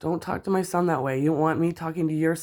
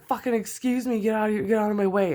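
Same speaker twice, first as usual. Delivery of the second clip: retold yell; 7 semitones higher than usual. Retelling telling the mother off.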